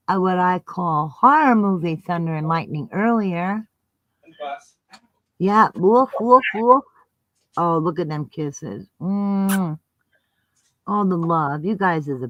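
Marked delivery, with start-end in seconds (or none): 5.4-6.8 barking. Pretend. Barking at dog photo.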